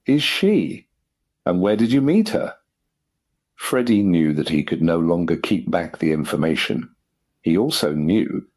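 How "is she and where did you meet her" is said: high, exasperated questioning; insistent demand. A narrator's reading. Father questioning his son about the fiancée, demanding. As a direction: keep pressing, pitched well up. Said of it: "demanded Lord Emsworth for the fifth time".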